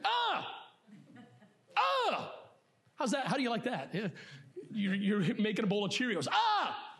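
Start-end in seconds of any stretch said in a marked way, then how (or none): 0.0-2.3 son's random scream. Retold or imitated. Pastor imitating his son screaming at breakfast, high mock yelp. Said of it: "Michael will just scream randomly". 6.3-6.9 son's random scream. Retold or imitated. Pastor imitating his son screaming at breakfast, high mock yelp. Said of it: "Michael will just scream randomly".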